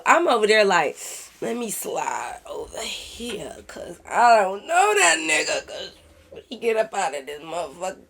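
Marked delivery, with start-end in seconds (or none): 1.4-8.1 sneaky, barely audible retelling; furtive whisper. Retold or imitated. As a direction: whisper as if slipping away unnoticed. Acting out sneaking away from trouble.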